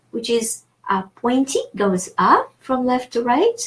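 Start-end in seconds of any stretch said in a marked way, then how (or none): none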